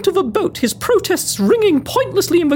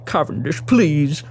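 much lower, downcast man's voice; despondent monster hunter; 11 semitones lower than usual. Acted. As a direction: confess failure in a low, hopeless voice. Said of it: "despondent to the point of weeping".

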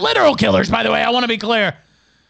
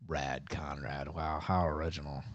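sarcastic wow; 17 dB quieter than usual. Real, not acted. Mocks the username, sarcastic.

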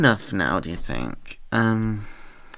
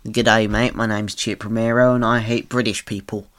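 Australian accent. Shown as a demonstration. Voice actor quoting the accent warm-up line, Australian accent. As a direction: lively attempt at an Australian accent. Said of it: "get into the accent".

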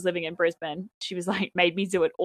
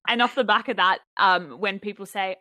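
ordinary talk

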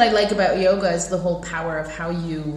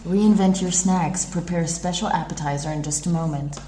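ad voice; 3 semitones lower than usual. Retold or imitated. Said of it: "what does it say on the packaging".

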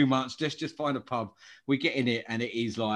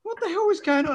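much higher, incredulous cry; shocked disbelief; 4 dB louder than usual. Retold or imitated. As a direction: yelp it out like you just got puked on. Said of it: "And I was like".

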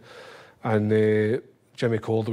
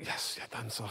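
hushed glee; 14 dB quieter than usual. Retold or imitated. Private joy, hushed.

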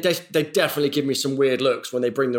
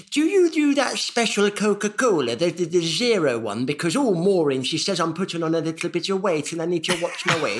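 Derek voice; 2 semitones higher than usual. Acted. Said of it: "it's just a voice".

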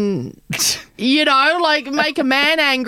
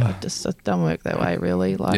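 mock low voice; 5 dB quieter than usual. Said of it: "and you're like".

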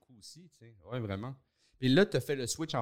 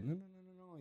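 soft coaxing no; 6 semitones higher, 12 dB quieter, much faster than usual. Retold or imitated. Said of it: "Il fait".

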